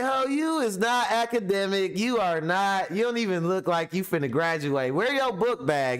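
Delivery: high-pitched mocking scold of a bragging girl. Friends mocking a girl bragging about college, high mocking voice. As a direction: squeak it out, mocking her for having no book bag. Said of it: "we thinking like".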